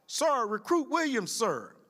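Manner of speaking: high slow recruit sound-off, lightly voiced; retold shout. Recruit answering her instructor, high retold shout. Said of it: "I heard a female voice shout".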